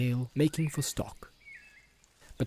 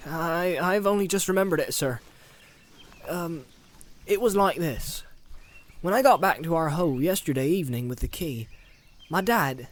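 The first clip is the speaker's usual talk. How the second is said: Sam voice; 5 semitones higher, 3 dB louder than usual. Acted.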